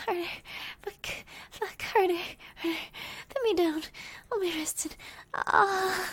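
loud pleading moans; urgent loud pleading. Acted. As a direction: plead urgently, near a cry. Urgent pleading during sex.

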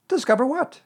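high, worried little creature; anxious. A narrator's reading. Piglet asking Pooh about the expedition, anxious. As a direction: pitch it right up, loud and anxious. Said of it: "said Piglet anxiously".

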